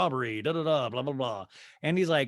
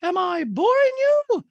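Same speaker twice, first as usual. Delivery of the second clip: very high, loud, stretched-out mock drama; hammed-up shouted impersonation; Dorit; 7 dB louder, slower than usual. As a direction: caricature her, slow and over-emphatic. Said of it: "And Dorit's like".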